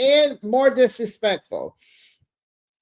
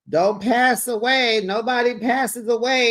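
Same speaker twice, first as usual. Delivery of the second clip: mock scream; 6 semitones higher than usual. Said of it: "Jared screams out".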